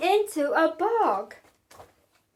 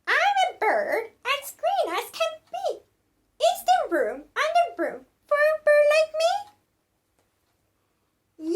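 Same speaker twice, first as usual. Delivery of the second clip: much higher, fast chirpy bird voice; little bird voice; 10 semitones higher than usual. Acted. The green bird asking the witch for room, high chirpy voice. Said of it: "then said".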